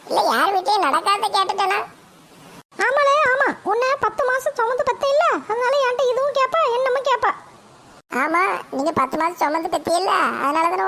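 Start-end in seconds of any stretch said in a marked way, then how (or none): 2.9-3.5 high-pitched mother fuming about her son; shrill-pitched scolding mum, fed up; annoyed mother. Acted. Mother complains to grandma about her son, high and irritated. 3.7-5.3 high, mocking mother's taunt; mother's sarcastic voice. Mother taunts her son, high and sarcastic. 5.5-7.3 high-pitched mother fuming about her son; shrill-pitched scolding mum, fed up; annoyed mother. Acted. Mother complains to grandma about her son, high and irritated.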